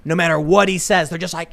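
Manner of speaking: ordinary talk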